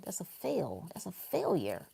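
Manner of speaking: hushed, dropped whisper; whispered verdict. Real, not acted. Whispering the verdict to the audience. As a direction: breathe the verdict out softly.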